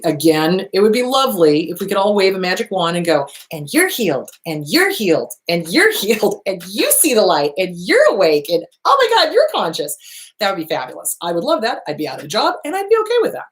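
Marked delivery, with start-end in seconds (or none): 3.5-10.0 mock magical healing. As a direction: play the wand-waving miracle worker, hammed up. Imagined magic wand healing everyone.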